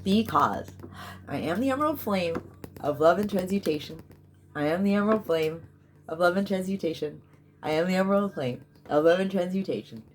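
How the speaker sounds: commanding ritual declaration; incantation decree. Real, not acted. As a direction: proclaim it like a spell.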